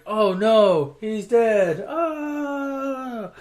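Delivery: hammed-up fake sob; mock mourning for Deckard Shaw; mock crying. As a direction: wail it like a badly faked grief. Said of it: "It's like".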